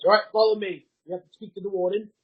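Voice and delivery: guard giving a firm, loud summons; commanding guard. Acted. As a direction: order them along like a guard. Guard orders player to follow.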